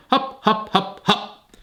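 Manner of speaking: high calls of hup to the hen; calling commands. Acted. Arthur coaxing Henrietta down the ladder, high calling. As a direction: call each hup to coax the chicken. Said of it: "Arthur shouted again".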